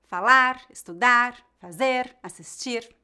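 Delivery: "Falar, estudar, fazer, assistir" as careful examples, leaning on each ending; final-syllable stress. Shown as a demonstration. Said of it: "têm a tônica na última sílaba".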